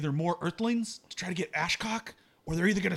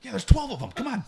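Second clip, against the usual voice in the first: higher, louder push to act; exasperated urging; 8 semitones higher, 6 dB louder than usual. Acted. Fighter urging hesitant archer to attack, exasperated. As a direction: press a reluctant partner impatiently.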